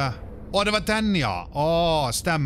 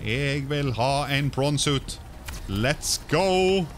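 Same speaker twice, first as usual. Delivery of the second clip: higher, eager, stretched-out cheering; excited cheering; 3 semitones higher, much slower than usual. Real, not acted. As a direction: hype yourself up for the new suit. Finally building the prawn suit. (begeistret og ivrig jubel)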